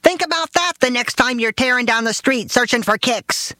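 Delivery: very high, scolding father voice; father lecturing mother over the cat; shouting father. Retold or imitated. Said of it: "my father shouted".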